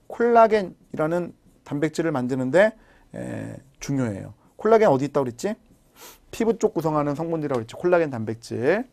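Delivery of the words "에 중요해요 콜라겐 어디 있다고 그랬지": ordinary delivery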